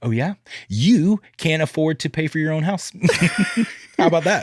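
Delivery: put-on high, loud cocky jab at a kid; mock trash talk. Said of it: "I'm like".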